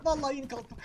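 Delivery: whiny plea; pleading to cat. Real, not acted. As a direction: beg the cat to stay away. Begging the cat not to come.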